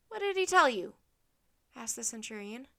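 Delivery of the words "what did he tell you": louder, higher interrogation; pressing question. A narrator's reading. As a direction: press the prisoners for an answer. Centurion interrogates the children.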